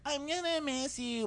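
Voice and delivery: put-on high, slow sweet girlfriend voice; mock girlfriend. JoJo saying goodbye at the car, high cooing voice. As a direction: coo it way up high. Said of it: "she's like".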